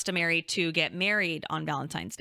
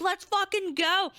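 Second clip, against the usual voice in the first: high, hyped mock cheer; imitating mom celebrating; 12 semitones higher than usual. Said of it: "She was so excited".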